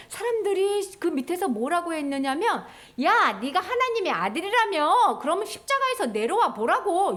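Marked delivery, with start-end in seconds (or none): 3.0-7.1 higher-pitched, swooping taunt; acted bystander's sing-song mockery; mocking jeer. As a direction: jeer at someone who cannot fight back. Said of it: "이렇게 조롱했어요".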